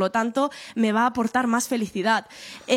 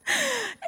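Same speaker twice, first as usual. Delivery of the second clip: short laugh; 13 semitones higher, 5 dB quieter than usual. Real, not acted. Laughs at the video detail.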